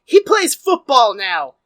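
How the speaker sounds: loud, slow proclamation; owner's proclamation. Retold or imitated. Team owner booming at the crowd, loud and slow. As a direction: announce it loudly, stretching each word. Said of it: "the owner is like".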